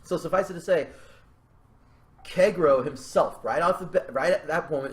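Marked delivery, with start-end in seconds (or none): none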